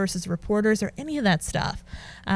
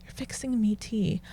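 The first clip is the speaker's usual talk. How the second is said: soft, baffled echo; hushed retold puzzlement; puzzled repeat; 9 dB quieter than usual. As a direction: repeat it quietly, working it out. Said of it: "And I'm like".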